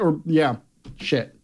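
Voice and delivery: ordinary delivery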